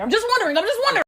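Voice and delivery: high faux-innocent "just wondering"; mock innocent. Real, not acted. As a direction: play innocent, pitch up.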